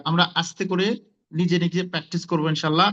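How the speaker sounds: usual speaking voice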